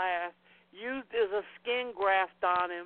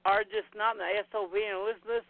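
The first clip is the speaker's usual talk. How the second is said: ordinary delivery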